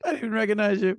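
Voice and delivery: high, loud mock exclamation; hammed-up character line; punchline replay.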